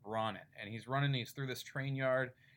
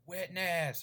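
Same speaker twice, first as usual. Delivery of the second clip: gang scream; 7 semitones higher, 5 dB louder than usual. Retold or imitated. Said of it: "screaming at him".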